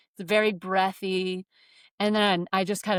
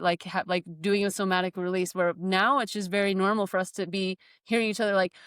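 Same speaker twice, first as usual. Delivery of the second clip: ordinary talk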